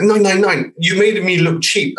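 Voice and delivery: high-pitched imitation of an outraged client; retold angry rant. Client complaining about the advertised price, high mock outrage. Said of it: "shouting, screaming".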